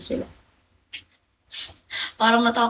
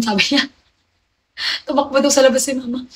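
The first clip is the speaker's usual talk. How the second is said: normal talking voice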